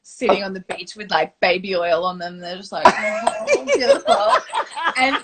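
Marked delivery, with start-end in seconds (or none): 2.9-4.3 mock-gushing, much higher and louder. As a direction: coo it like a swooning soap actor. Cast gushing on the beach.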